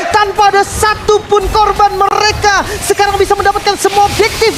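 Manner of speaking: fighting game announcer. Acted. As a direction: yell the wipe out like a climax. Co-caster erupts at team wipe.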